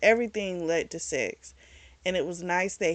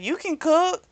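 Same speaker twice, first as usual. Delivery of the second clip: retold disbelief, higher and louder; surprised question; 10 semitones higher, 5 dB louder than usual. He teases her offer of breakfast, surprised. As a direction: lift the pitch in mock surprise.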